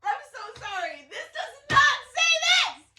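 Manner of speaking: very high, squealing disbelief; shrieking, overwhelmed astonishment; excited disbelief. Real, not acted. Stunned by the card reading.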